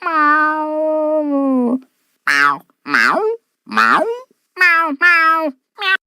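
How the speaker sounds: repeated musical meowing; snail meowing. Acted. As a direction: meow back like a pet snail. Meow melody sung.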